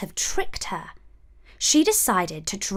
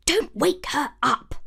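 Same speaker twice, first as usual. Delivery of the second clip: higher, lightly voiced dwarf warning; hushed dwarf; 5 semitones higher than usual. A narrator's reading. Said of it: "ordered another".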